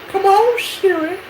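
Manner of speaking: very high, wheedling creepy voice; sweet creepy coaxing. Retold or imitated. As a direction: coax like a creepy stranger. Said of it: "creepy guy voice".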